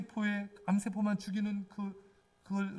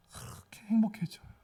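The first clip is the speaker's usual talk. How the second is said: whisper. Acted. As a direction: breathe the words like a secret. Sermon intimate aside. (속삭이듯 조용하게)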